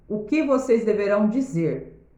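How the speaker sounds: ordinary talk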